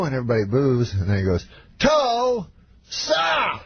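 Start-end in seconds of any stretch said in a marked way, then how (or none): 1.8-3.6 very high call, syllables split far apart; Bill Cosby. Retold or imitated. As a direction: mimic a star milking the cheer, extremely drawn out. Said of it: "every time slower".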